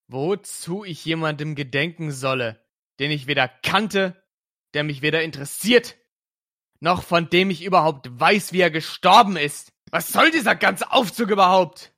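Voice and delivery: loud, building furious challenge; rising into a shout at his mother; angry shouting. Acted. Detective angrily confronting his mother.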